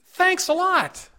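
genuinely grateful, lilting up; sincere thanks. Shown as a demonstration. Preacher showing the congregation a good tone, sincere. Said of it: "The tone makes a difference".

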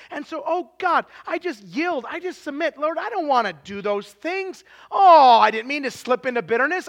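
high, pleading prayer at preaching force; acted repentant prayer. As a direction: cry out to God in mock-up repentance. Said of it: "They're like".

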